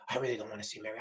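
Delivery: low, very faint murmur; weak, barely-there voice; lost voice. Acted. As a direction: talk with the voice nearly gone. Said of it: "I lost my voice".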